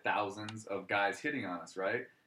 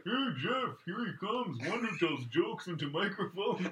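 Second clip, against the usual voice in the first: chief voice impression; 8 semitones higher, slower than usual. Girlfriend's dad greeting the comedian, slow chief voice.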